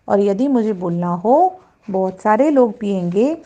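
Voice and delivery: normal talking voice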